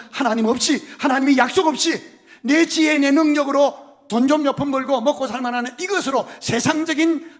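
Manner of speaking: loud, high, impassioned sermon climax; preaching at full force. Acted. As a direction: drive it home to the congregation. (열정적으로 외치는 설교)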